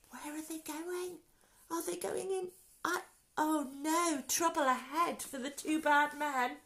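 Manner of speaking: high-pitched, anticipating trouble; suspenseful narration. A narrator's reading. Narrator asking children where the bad men go, high suspense.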